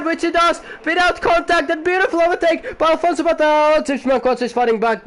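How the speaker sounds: excited live play-by-play, building to high and loud; opgewonden racecommentaar. Real, not acted. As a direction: call the overtakes in a high, excited commentator's shout.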